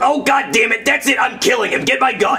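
angry outburst. Acted. Kidnapper loses temper.

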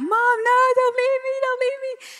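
put-on high, loud panicky little-girl plea; imitating scared child. Scared little daughter begging mom, high panicked plea. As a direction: squeal it like a frightened small child. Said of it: "she was so scared".

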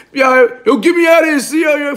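high-pitched call for help; mock pleading call. Inmate calling the C O to get out, mock pleading. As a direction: imitate a desperate holler to the guard.

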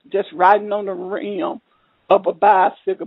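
ordinary delivery

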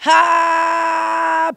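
long, high held "haaa"; drawn-out cry. Real, not acted. Host after hailing a name, drawn-out cry. Drawn-out exclamation in shout-outs.

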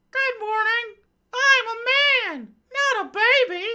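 high comic falsetto husband, slowed and sobbing; whiny husband voice. Pretend. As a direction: play the husband as a squealing big baby. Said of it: "her conservative husband".

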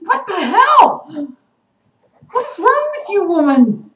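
loud, high-pitched outburst; exasperated shout. Real, not acted. Vlogger ranting at a grovelling character, exasperated shout. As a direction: yell it in utter exasperation.

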